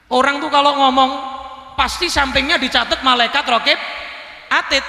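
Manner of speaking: lively preacher emphasis; excited explanation. Real, not acted. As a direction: push it up with enthusiasm.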